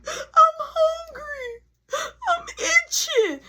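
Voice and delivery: high, slow whining wail; crying kid. Retold or imitated. Little brother whining his excuses, high slow wail. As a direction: whine every complaint out. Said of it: "He like".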